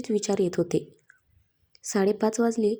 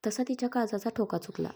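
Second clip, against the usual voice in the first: usual speaking voice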